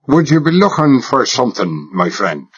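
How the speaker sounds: lightly voiced rough Irish foreman, deep and no-nonsense; a hint of Irish, blunt and gruff; a touch of Irish growl, suspicious question; Irish brogue. A narrator's reading. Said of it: "deep, rough, no-nonsense voice, as Irish as a sprig of shamrock".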